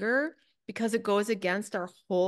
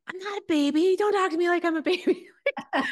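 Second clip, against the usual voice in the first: much higher and louder, a petulant squeal, fast and laughing; indignant inner child; 10 semitones higher, 6 dB louder than usual. Retold or imitated. To a too-gentle practice teacher, high laughing squeal of protest. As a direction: squeak it out like an offended toddler. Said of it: "and I'm like".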